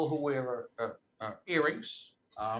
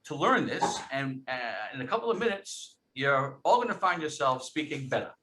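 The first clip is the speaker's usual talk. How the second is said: normal talking voice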